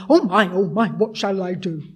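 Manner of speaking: loud hammed-up fretting, oh my oh my; mock panic. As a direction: play God as a mock-panicked worrier, loudly. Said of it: "he's panicking".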